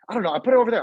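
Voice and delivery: defensive. Retold or imitated. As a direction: snap back defensively to get him off your back. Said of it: "you don't have to be so defensive".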